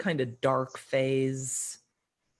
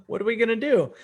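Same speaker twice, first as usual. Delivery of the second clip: excited question; 8 semitones higher, 6 dB louder, faster than usual. Retold or imitated. Eager reply to retreat invite.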